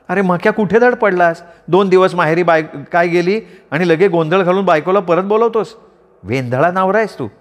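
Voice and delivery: scolding wife. Acted.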